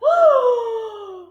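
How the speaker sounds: high sharp startled gasp; shocked gasp. A narrator's reading. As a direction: gasp in horror. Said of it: "heard the gasp".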